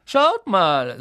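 high, loud sneer; Malfoy jeering. Acted. Malfoy calling classmates over, sneering. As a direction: call the others over with a smirk.

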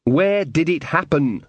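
deliberate, distinct questioning; slow and distinct. A narrator's reading. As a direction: spell it out slowly for someone not following. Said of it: "trying to speak slowly and distinctly".

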